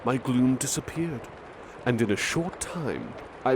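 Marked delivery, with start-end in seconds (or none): none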